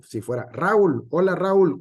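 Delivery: Raúl said as RÁ-ul, one gliding first syllable, loud; stress on the A. Shown as a demonstration. Teacher shows a hypothetical wrong accent, Raúl stressed on the A. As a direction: punch the a in Raúl and let ul fall away. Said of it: "con el acento en la A".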